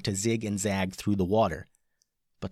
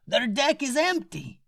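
amazed captain. A narrator's reading. Captain amazed through telescope.

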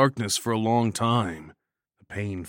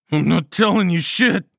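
louder, higher defiant grumble; 10 semitones higher, 10 dB louder than usual. A narrator's reading. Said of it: "I grumbled".

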